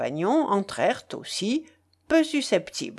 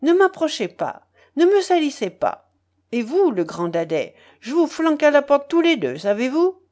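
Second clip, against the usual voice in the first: high, loud, indignant warning; outraged woman's scolding; furious; 6 semitones higher, 5 dB louder than usual. A narrator's reading. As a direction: drive them off with sharp commands. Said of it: "Furieusement, elle les repoussa".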